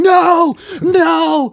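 high, loud retold scream; Mike screaming. Friend imitates Mike chasing his runaway truck, screaming. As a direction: scream it in panic, imitating him. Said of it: "he's just screaming".

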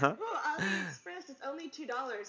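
high, quick excited shopper voice; teasing impression of an online shopaholic; mock shopaholic. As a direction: play the giddy bargain hunter.

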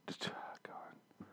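slow exasperated whisper. Real, not acted. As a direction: breathe it out, slow and unvoiced. Exasperated aside.

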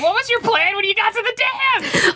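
high, cracking incredulous cry. Real, not acted. Podcaster cries out at the hero, high incredulous wail. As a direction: wail the question in disbelief. Yelling at character.

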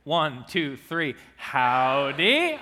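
loud, drawn-out group howdy; howdy shout. Real, not acted. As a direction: call it out into the mic. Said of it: "say it right into my microphone".

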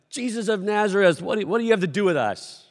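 high, loud possessed man's outcry; shouting out. Acted. Possessed man confronts Jesus in the synagogue, shouting out. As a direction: cry out loudly, disrupting the room. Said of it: "He begins to shout out".

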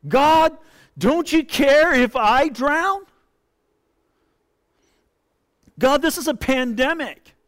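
begging God, voice pushed up in distress; desperate plea. Acted. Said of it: "perhaps that's what you're thinking".

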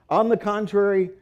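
preacher reading aloud, stressing key syllables; emphatic scripture reading. Acted. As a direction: read the passage to the congregation with weight.

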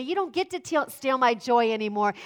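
ordinary talk

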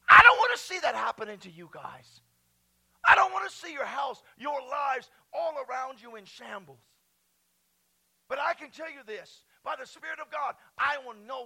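very loud, high preaching cry; fiery shout to the congregation. Real, not acted. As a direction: cry it out at full force.

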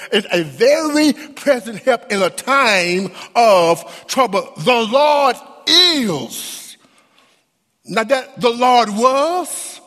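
high, ringing preacher's cry; full-force pulpit preaching; impassioned preaching. Acted. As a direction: lift the congregation with rising fervor. Preaching, building to the I am.

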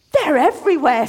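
amazed cry at the stars. A narrator's reading. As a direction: gasp it out in wonder. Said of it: "said Reuben, amazed".

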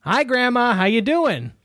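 high, cheerful little bug voice; Ted voice. Acted. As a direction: chirp it like an eager little critter. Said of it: "[name], you're Ted".

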